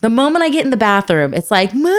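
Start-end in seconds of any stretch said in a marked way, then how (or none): 1.7-1.9 drawn-out mock kid's "Mom"; imitated calling child. Child calling mother in the bathroom, imitated whine. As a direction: call out like a needy child. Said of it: "an alarm goes off in your kid's head".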